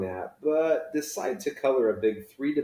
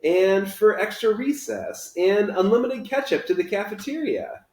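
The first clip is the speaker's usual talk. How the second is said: put-on higher, louder eager boy voice; excited kid; 10 semitones higher, 6 dB louder than usual. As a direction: rattle off the wishes with glee. Oliver's excited wish list.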